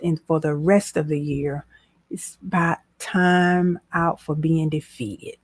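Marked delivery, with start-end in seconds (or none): none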